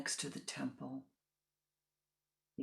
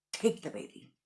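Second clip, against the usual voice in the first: louder, shoved-off order; curt command; 5 dB louder than usual. Acted. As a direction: push the baby on him. Parents thrust baby at monk.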